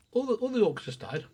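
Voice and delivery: usual speaking voice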